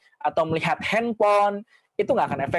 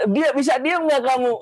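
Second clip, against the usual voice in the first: mock scolding; 8 semitones higher, 3 dB louder than usual. Parent scolding child.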